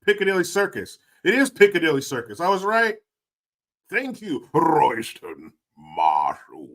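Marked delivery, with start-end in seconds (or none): 3.9-6.7 posh British. Pretend. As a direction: savour the name in a plummy British accent. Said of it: "I like your British accent".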